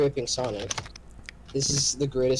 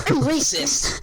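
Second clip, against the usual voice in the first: high put-on cartoon hedgehog; squeaky jokey character voice; hammed-up hedgehog shout; mock Sonic voice; 13 semitones higher, 4 dB louder, slower than usual. Mocking Sonic voice.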